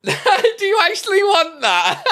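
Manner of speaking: high, slow question through laughter; can barely ask it for laughing; laughing disbelief. Real, not acted. Asking a viewer about the emote, laughing hard.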